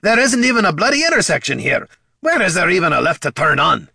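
loud, fed-up dwarf yelling; angry shout. Acted. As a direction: bellow at the stupid machine. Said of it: "to shout at the mechanical gazer".

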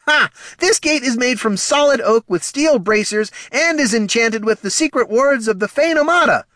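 high, loud gloating voice; crowing guard. Acted. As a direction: brag smugly about the gate. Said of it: "the guard crowed".

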